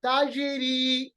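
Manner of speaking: slow ताजिरी with bounced j; qalqalah. Shown as a demonstration. Teacher shows qalqalah on jeem, slow ताजिरी with echo. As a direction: echo the j of ताजिरी, draw the ending. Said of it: "ठीक है, कलकला".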